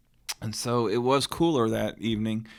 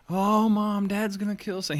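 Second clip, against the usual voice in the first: put-on high child's voice calling for mom; scared little kid; 8 semitones higher, 3 dB louder than usual. Young son running to mom about Santa, high pleading voice. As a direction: plead like a frightened boy.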